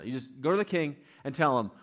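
ordinary delivery

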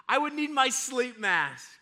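high, loud imitated kid plea; insistent child. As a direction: press it like a needy child. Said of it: "Now, for my kids".

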